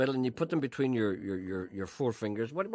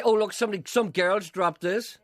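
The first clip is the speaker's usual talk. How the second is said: mocking imitation; 6 semitones higher, 5 dB louder than usual. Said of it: "You'd say".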